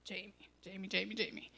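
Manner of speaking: softly repeated name, retold; hushed calling to get attention; God's gentle admonishment. God stopping Jamie in judgment, gentle soft admonishment. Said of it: "God stopped me and said".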